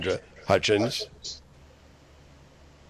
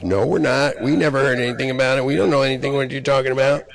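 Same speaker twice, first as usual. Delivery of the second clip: high, loud, fast whiny denial; sneering imitation of people pleading innocence; mocking denial; 5 semitones higher, 5 dB louder than usual. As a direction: mimic them brushing it off, louder and fast.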